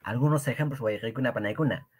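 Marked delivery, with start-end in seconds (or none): none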